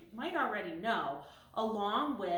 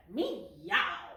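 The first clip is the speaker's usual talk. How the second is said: angry meow; 2 semitones lower, 3 dB louder than usual. Acted. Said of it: "she became angry and said".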